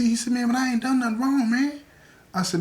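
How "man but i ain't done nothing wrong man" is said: high-pitched, insistent denial; acted plea of innocence; pleading protest. Accused man protests to Joe T, pleading.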